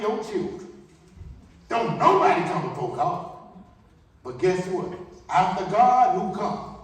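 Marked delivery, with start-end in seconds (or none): none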